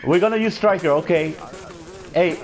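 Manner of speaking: shouting into the mic; loud. Real, not acted.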